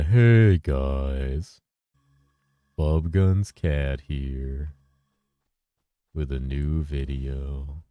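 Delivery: deep, slow droopy youtuber greeting; Fubgun impression. Streamer imitates Fubgun's intro, slow and low like Eeyore. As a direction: drag it out low like a gloomy donkey. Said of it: "sounding like Eeyore when I try to imitate him".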